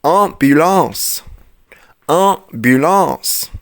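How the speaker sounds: امبولانس said slowly with nasal 'ã' at start and end; امبولانس with both an-sounds nasal, no n; slow nasal demo. Shown as a demonstration. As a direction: model امبولانس slowly twice. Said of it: "لما اقول ايه كمان؟".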